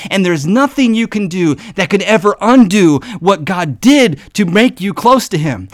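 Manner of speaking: rising preaching fervor. Real, not acted. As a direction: build the declaration with force. Building preaching crescendo.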